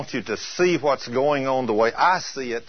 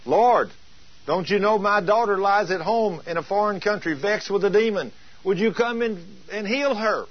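higher-pitched urgent plea; begging; 6 semitones higher than usual. Retold or imitated. Preacher voicing the Syrophoenician woman asking healing for her daughter, begging. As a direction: beg the Lord on a daughter's behalf. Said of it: "she came to the Lord begging".

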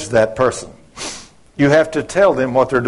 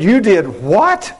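slow, higher-pitched disbelief; incredulous; 5 semitones higher, slower than usual. Retold or imitated. Coworker hearing the prank was made up, incredulous. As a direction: imitate an indignant double take, stretching it out.

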